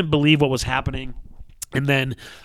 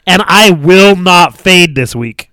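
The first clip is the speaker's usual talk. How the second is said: loud, slow, higher declaration; yelling into the mic; 5 semitones higher, 9 dB louder, slower than usual. Shown as a demonstration. Host vowing not to fade out, yelling slowly. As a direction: belt it out slowly so it can't fade. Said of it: "I'm gonna yell into it".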